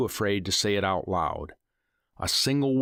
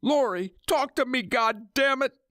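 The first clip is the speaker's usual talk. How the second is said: desperate scream. Acted. Husband screaming for his wife, desperate scream. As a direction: scream for her. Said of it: "he screamed".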